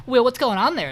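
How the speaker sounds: retold sharp demand; suspicious questioning. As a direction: call him out, acting the jealous date. Jealous date confronts Will.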